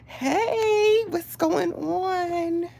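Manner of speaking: squealing high hello through laughter; giddy greeting. Real, not acted. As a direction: greet them while still giddy and laughing. Greets viewer, giddy over the show.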